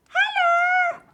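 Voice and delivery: put-on high, loud child voice; squeaky kid greeting. Child voice in game.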